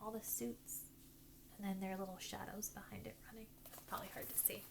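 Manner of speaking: hushed whispered aside; soft whispered aside to the listener. Real, not acted. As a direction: whisper it so no one hears.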